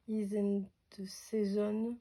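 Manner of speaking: slow English read with a heavy French accent; halting English in a French accent. Shown as a demonstration. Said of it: "Désolée pour mon accent".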